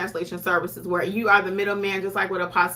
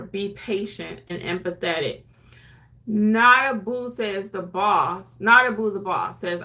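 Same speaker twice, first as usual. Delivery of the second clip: ordinary talk, nothing marked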